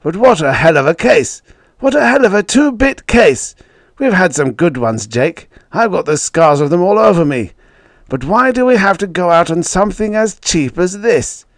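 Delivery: loud, high-pitched exasperation; impassioned, emphatic protest; fist-banging outburst. A narrator's reading. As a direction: rail bitterly at an old rival. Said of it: "Then he banged his fist on the desk".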